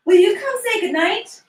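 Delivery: put-on a child begging mommy to come home; young daughter asking her mother on the phone when she's coming home; little girl.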